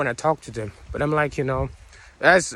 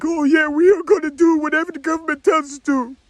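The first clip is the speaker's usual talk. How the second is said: high, dopey compliant voice; mocking voice; 8 semitones higher than usual. Sneering at the eighty-five percent, high dopey mockery. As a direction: play a brainless yes-man.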